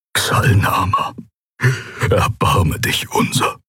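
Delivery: slow, voiceless plea to a god; whispered prayer. Acted. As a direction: breathe the prayer through tears. Tearful Skoru prays.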